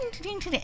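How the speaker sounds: high, quick little dings like a scanner; playful scanner-beep sounds; register beeps. Retold or imitated. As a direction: chirp out the checkout beeps.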